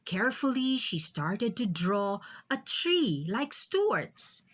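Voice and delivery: usual speaking voice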